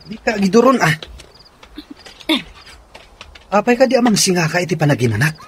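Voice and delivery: loud, high scolding; angry. Acted. Wife confronting her husband about his dream, angry. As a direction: demand an answer, heated.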